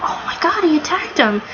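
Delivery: high, hushed mock gasp of disbelief; soft, high-pitched astonishment; retold shock. Fan recalling the Kraken's attack, retold shock. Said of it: "and I thought".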